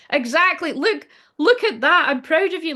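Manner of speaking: bright cheering, proud of her; excited praise. Real, not acted. Cheering on crying viewer.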